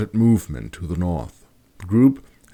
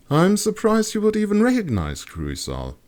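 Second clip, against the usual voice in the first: high, dry remark; high woman voice. Acted. Erika remarks.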